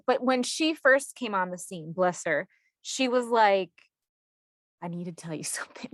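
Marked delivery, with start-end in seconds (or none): 4.8-5.9 hushed, fast, ominous whisper with a laugh; dramatic whisper. Retold or imitated. Host imitating her urologist's dramatic warning, whispered. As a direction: whisper it like a grave secret. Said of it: "that sounds so dramatic when I say it like that".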